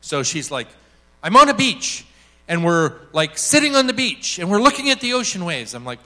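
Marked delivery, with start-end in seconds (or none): 1.2-5.7 high, loud eager blurting; manic excitement. Retold or imitated. Woman describing her imagined beach to the speaker, manic excitement. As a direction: imitate someone blurting out what she pictures. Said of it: "she was manic".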